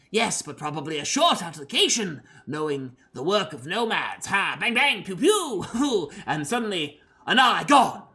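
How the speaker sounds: eager, theatrical mad scientist. Acted. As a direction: play it as a gleeful mad scientist. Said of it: "a generic mad scientist voice".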